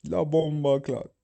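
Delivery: slow, exaggerated Jamaican accent. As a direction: drawl it out Jamaican style. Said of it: "Jamaican internet".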